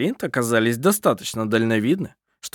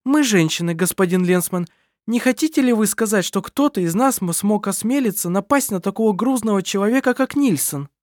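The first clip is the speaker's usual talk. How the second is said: indignant woman; 8 semitones higher than usual. A narrator's reading. Kerstin protests to Lensman.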